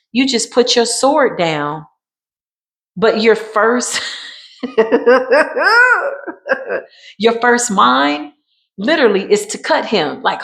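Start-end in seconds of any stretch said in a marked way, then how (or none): 4.6-6.8 real laugh. Real, not acted. Laughs mid-sentence during the reading.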